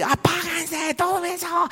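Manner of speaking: high mock plea from the teens; lightly voiced pained teenager; complaining youths. Teens beg to turn the sound off. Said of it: "los jóvenes riéndose".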